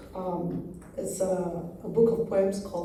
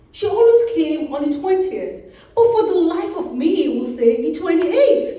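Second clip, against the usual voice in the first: high, loud, animated chatter; comic ignorant man running on loudly; rattling-on gentleman; 9 semitones higher, 11 dB louder than usual. A narrator's reading. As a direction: prattle away in raised pitch, oblivious. Poem voices the rattling gentleman.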